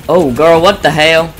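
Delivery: high and loud, alarmed; startled loud outburst. Real, not acted. Gamer spooked by something in the game, loud alarmed outburst. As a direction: yelp it out at the screen.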